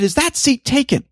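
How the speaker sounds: very high sharp mock demand; mock impatience of a stranger wanting the seat; imitated snap. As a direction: bark it at him high and impatient. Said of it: "she snapped at me".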